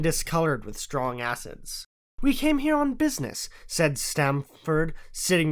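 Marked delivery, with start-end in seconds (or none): 2.2-3.5 Stamford's voice. A narrator's reading. Stamford introduces them.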